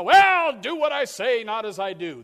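high, loud preachy parent brushing it off; hypocritical parent. Acted. Preacher mocking a parent who won't practise, loud and high.

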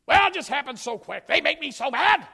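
high, loud, exasperated parent; flustered parent excuse. Acted. Preacher mimicking a parent excusing an angry spanking, high and loud. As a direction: whine it like a parent caught out.

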